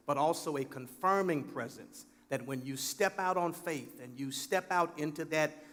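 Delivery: normal talking voice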